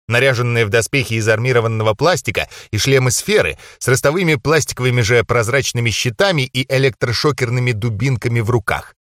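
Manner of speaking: ordinary delivery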